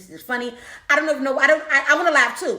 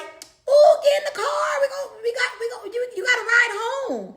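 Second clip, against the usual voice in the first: high excited yelling, voice near cracking; excited shout; 12 semitones higher than usual. Retold or imitated. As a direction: call out over-eager from the car window. Aunt and sister calling from car.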